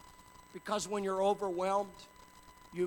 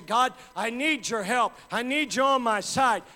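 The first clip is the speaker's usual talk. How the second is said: loud, higher cry for help; loud plea; 6 semitones higher, 11 dB louder than usual. Pretend. A believer in trouble crying out loud to God. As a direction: call out to God with everything.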